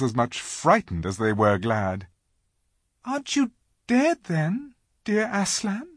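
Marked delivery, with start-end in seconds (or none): 3.0-5.9 put-on high, wondering little girl; timid hope; Lucy's voice. A narrator's reading. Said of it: "said Lucy".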